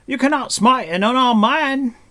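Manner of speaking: high, loud outcry; shouted protest. A narrator's reading. Unarmed stranger protesting Taran's attack, high and loud. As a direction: wail it in indignant alarm.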